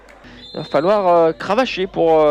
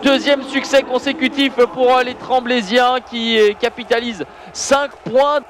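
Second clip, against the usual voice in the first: excited play-by-play; 6 semitones higher than usual. Real, not acted. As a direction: call the final save with full excitement. Final save and final whistle.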